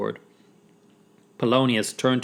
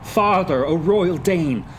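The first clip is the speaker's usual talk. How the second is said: higher, insistent address; controlled resolve; 6 semitones higher than usual. Acted. Hamlet addressing his father's spirit, taking control. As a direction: demand answers with command. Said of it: "he swiftly took control".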